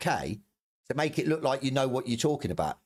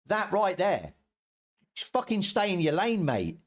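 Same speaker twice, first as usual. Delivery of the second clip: higher, heated telling-off; angry outburst; 6 semitones higher than usual. Real, not acted. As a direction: put him in his place, pitch up and furious. Angry rant at another streamer.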